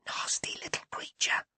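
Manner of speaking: breathy unvoiced whisper, low and secret. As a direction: hiss it as a private aside. Whispered aside about sister.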